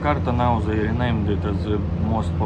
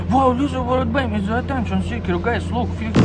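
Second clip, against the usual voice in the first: much higher-pitched mock wow; mock amazement; 13 semitones higher than usual. Streamer teasing little bird baby as attention seeker, mock amazed. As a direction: tease in a high, put-on voice of amazement.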